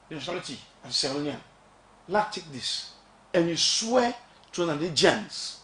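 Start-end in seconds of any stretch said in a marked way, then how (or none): none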